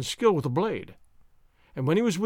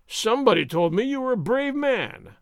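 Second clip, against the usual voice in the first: higher, taunting sergeant; mocking taunt; 6 semitones higher than usual. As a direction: goad him about his courage. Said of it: "Gonzales taunted".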